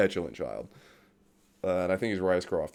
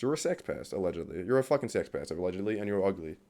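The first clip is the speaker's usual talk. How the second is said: usual speaking voice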